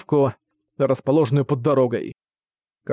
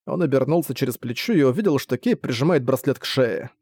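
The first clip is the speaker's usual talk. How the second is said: ordinary delivery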